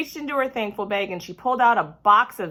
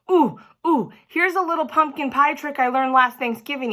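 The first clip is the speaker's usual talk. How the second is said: put-on eager kid; 6 semitones higher, 3 dB louder than usual. A narrator's reading. Lenny waves hand urgently.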